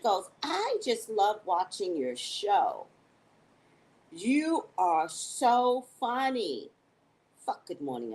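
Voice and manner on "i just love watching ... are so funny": slow, high, drawn-out praise; gushing fan. Retold or imitated. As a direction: gush slowly, stretching each word. Acting out Sheena's gushing message.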